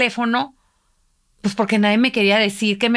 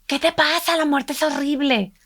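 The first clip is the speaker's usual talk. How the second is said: higher, mock indignant outburst; quoting her angry reply to her husband, higher and mock indignant; retold anger; 6 semitones higher than usual. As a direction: snap back at her husband, pitched high. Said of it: "me enojé muchísimo con tu papá".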